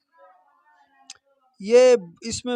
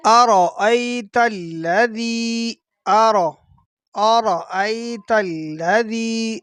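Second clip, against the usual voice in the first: Quran recitation. Shown as a demonstration. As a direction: recite the verse in measured tajweed. Teacher recites Quran verse.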